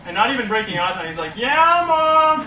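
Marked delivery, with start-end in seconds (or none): 1.4-2.4 put-on high, loud kid shout; loud high shouted answer to mom; kid yelling back. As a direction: yell it without looking away.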